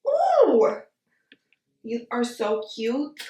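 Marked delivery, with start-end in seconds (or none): 0.1-0.8 excited squeal. Real, not acted. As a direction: squeal it out with delight. Squealing at the palette.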